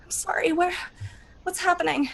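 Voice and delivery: high, frantic, disoriented panic; panicked confused questions; panicked waking. Acted. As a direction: wake from a nightmare in terror. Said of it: "Sweating and crying".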